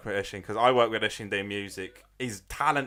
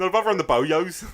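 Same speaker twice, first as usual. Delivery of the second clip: louder, higher send-up of the name; mocking catchphrase voice; 7 semitones higher, 7 dB louder than usual. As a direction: tease the word Boyos, laughing. Mocking how others would say Boyos.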